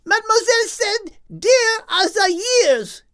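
high, loud cut-in; loud interjection. Acted. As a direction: chime in loudly. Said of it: "interjected Henriette".

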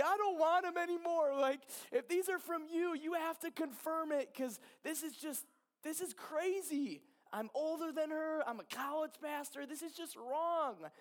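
high, pleading retold prayer, loud at first; crying out to God. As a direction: plead up at God in desperation, pitch held high. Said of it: "literally just crying out to him".

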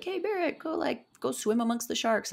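high, lightly voiced teasing friends egging her on; mock coaxing friends. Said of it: "they're trying to be like".

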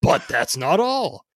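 loud, high hard-sell pitch; carnival barker. Acted. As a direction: hype it like a fairground barker. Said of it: "read this in sort of a carnival barker".

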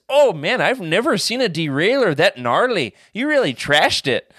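hammed-up Canadian accent, excited. Helpful stranger praising the trashed derailleur, in a hammed-up Canadian accent. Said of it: "I can't tell which accent you're going with".

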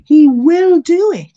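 "will do it" high, loud and drawn out; slow, forceful push on "he will do it"; emphatic conviction. Real, not acted. Assuring believers of God's promise, emphatic.

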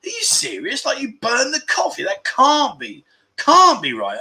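high, disbelieving questions; incredulous. Retold or imitated. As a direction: sound like you can't believe it. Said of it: "I'm like".

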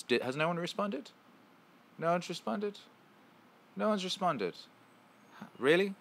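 high-pitched incredulous questioning; surprised. Real, not acted. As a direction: ask it in high-pitched disbelief. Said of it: "I'm surprised".

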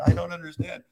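high-pitched, mock frustrated confusion. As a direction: complain like a baffled dog. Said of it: "I'm frustrated and going".

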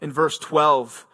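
ordinary talk

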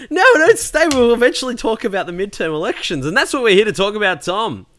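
high, loud, laughing through the words; laughing plea. Real, not acted. Telling listeners to stay, laughing plea.

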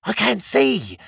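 high-pitched creature cry; high, distressed lizard voice; screeching lizard. A narrator's reading. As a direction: screech it in blinded alarm. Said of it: "screeched several lizards".

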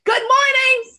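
high, loud, sing-out good morning; yelled greeting. Shown as a demonstration. Walker greeting anyone who comes near, yelled cheerfully. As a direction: call it out boldly to passers-by. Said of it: "I say hi and good morning".

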